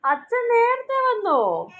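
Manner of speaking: high, slow, stretched question; delighted mock surprise. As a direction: play up the surprise for a baby. Reacting amazed to a baby's babble.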